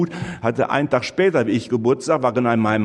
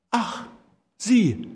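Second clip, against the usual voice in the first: surprised; 9 semitones higher than usual. Retold or imitated. Surprised widow at the door.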